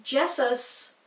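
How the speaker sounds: Jesus said JESS-us; short e and hissed s in Jesus; awkward pronunciation. Shown as a demonstration. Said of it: "just doesn't sound right".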